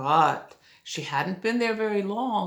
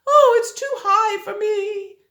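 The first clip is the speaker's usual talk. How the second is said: put-on high, loud little girl voice; girl struggling onto a tall bed; 9 dB louder than usual. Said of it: "and said".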